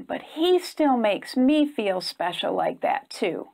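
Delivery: ordinary delivery, nothing marked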